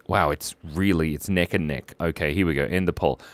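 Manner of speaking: ordinary talk, nothing marked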